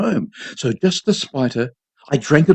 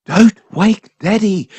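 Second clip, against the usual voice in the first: slow, louder, hissed through the teeth; angry hiss; 7 semitones higher, 5 dB louder, slower than usual. A narrator's reading. Mother silencing her son before he wakes father, hissing angrily. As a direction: shut him up fast and fierce. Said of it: "she hissed angrily".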